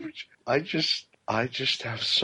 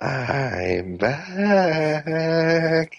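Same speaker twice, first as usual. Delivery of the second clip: lurk and threaten; creepy voice; 6 semitones higher than usual. Acted. Creepy caller returns.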